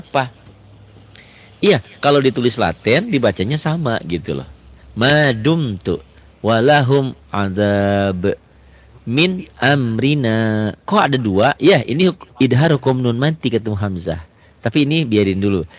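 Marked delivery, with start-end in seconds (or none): 5.0-10.7 slow, melodic tajwid chanting; chanted Quranic reading; Quran recitation. Shown as a demonstration. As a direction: recite it slowly with drawn-out vowels. Recites examples in sequence.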